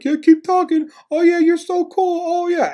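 very high sneering imitation; mocking taunt. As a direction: mimic a heckler in a silly high voice. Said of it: "And this guy's like".

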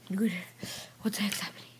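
very soft, frightened whisper; scared whisper. Pretend. Hiding from the spirit, frightened whisper. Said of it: "I'm so scared".

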